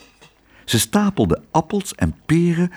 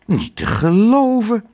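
astonished cry; 8 semitones higher than usual. A narrator's reading. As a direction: gasp it out, stunned by the mess. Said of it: "Ze keek en riep".

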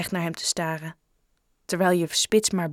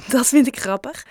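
higher, louder, amused; a touch of giggle without laughing out; lightly tickled; giggly amusement; 6 semitones higher, 5 dB louder than usual. A narrator's reading. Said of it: "giechelde Lauren".

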